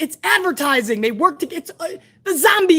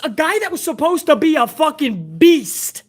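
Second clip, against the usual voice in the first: ordinary talk, nothing marked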